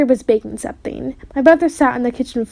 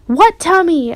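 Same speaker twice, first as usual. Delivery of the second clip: high, louder shout; 7 semitones higher, 4 dB louder, slower than usual. A narrator's reading. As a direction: shout at the little brother. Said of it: "I shouted at my brother".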